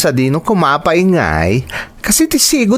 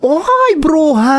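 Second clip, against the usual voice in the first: excited greeting; much slower than usual. Acted. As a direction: shriek a playful hello. Friend greets old friend loudly.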